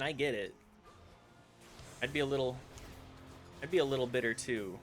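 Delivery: normal talking voice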